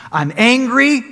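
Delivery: sudden loud angry outburst. Shown as a demonstration. Preacher acting out indignant disciples, angry. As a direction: voice an angry complaint, loud and high. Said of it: "Indignant means you're angry".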